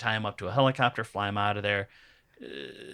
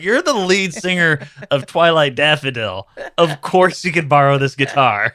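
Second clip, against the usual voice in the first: gushing fan; 2 semitones higher, 8 dB louder than usual. Retold or imitated. Acted gushing reply to singer's request.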